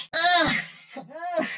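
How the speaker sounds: "ugh" at a foul stench; disgusted groan. Acted. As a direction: groan in revulsion at the smell. Reacts to a stench.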